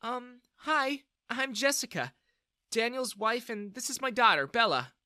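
high-pitched female figure voice; polite, tentative visitor; polite older lady. A narrator's reading. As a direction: introduce yourself hesitantly, pitched well up.

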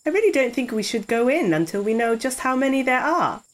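usual speaking voice

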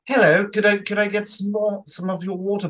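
high, put-on posh English accent. Film critic mimicking a posh actor playing Cockney, very posh accent. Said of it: "says things in a very posh accent".

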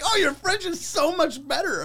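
very high mock gushing; gushing praise. Comic mimicking audience praising his French, high gushing. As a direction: coo the compliment in a put-on high voice.

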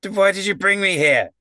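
high-pitched aggrieved demand; put-on high, upset clown voice; indignant clown. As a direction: whine the question in protest.

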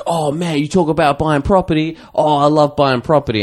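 eager stranger voice. Retold or imitated. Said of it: "He's like".

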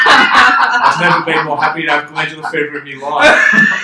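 loud, high laughing outburst; laughing shout. Real, not acted. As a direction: roar it out through real laughter. Gleeful about glandular fever.

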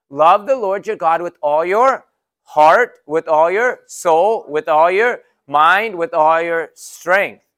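slow, loud, emphatic recitation of scripture; scripture reading. Acted. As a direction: proclaim each phrase slowly and loudly.

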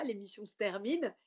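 ordinary delivery

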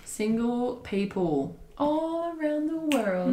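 slow, drawn-out announcer voice; grand announcer. Pretend. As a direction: proclaim it like a show host. Announcing a new section.

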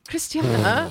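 put-on low go at a British accent on the name; badly faked British "Christiana"; mock British accent. Friend trying her old British accent, mock British. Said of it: "it sounded very British".

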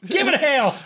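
high-pitched mock encouragement; high, exaggerated movie-quote voice; hammed-up cheering on. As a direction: urge him on like a rowdy bystander. Quoting bathroom scene with co-host.

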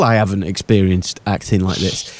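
ordinary talk, nothing marked

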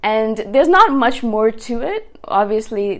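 normal talking voice

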